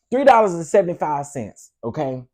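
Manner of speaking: ordinary talk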